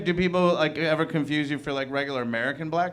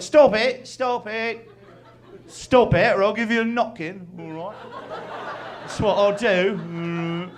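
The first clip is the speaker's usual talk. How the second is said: high, hammed-up British threats; imitated British indignation, pitched up; mock angry Brit; 6 semitones higher, slower than usual. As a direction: threaten in a cartoon British accent. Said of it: "this guy in Vegas got so mad".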